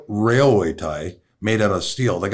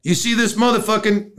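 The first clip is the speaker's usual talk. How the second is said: high-pitched cry on the name; retold high, agitated outburst; alarmed union guy; 11 semitones higher than usual. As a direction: come running in with urgent news, voice way up. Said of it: "this union guy comes running out".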